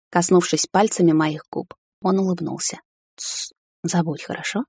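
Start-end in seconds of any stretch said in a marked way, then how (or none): 3.2-4.6 hushing softly. A narrator's reading. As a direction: soothe her into silence, very softly. Husband hushes her gently. (тихо и медленно, успокаивая)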